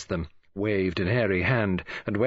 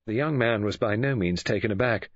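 ordinary delivery

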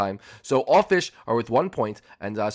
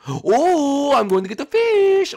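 excited; 14 semitones higher, 6 dB louder, slower than usual. Acted. Excited fishing moment.

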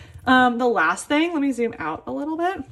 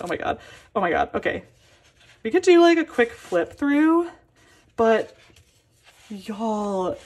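normal talking voice

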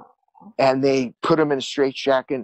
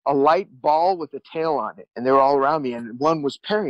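usual speaking voice

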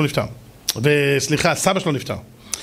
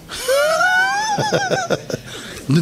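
put-on very high, long baby wail; imitated newborn cry. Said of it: "תינוק נולד עושה ככה". (חיקוי בכי גבוה של תינוק)

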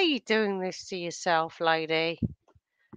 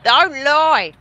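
loud, higher accusing don't lie; 6 semitones higher, 8 dB louder, much slower than usual. Real, not acted. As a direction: call out the lie. Accusing friend.

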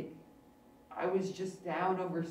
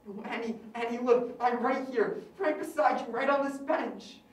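higher, louder pleading to be noticed; desperate plea; 4 semitones higher, 7 dB louder than usual. Acted. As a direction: call out, begging her to see him. Unheard ghost begs her to notice.

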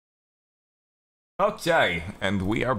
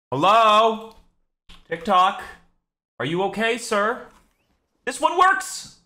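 high loud calls at the phone; excited high calls; 14 semitones higher, 5 dB louder, slower than usual. Real, not acted. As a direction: yelp with delight when it works. Clip not working, calling out.

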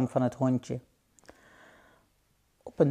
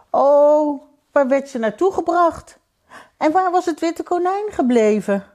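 high, desperate questioning; distraught; 11 semitones higher, 5 dB louder than usual. Acted. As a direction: wail the questions in rising distress. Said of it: "Het hondje was radeloos". (radeloos, met hoge stem)

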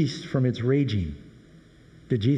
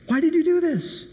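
frightened demand; 14 semitones higher than usual. Acted. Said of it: "exceedingly afraid and said".

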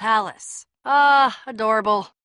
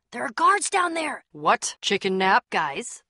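normal talking voice

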